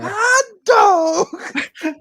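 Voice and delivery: high, hammed-up country holler; mock country whoop. Mock country exclamation at county fair.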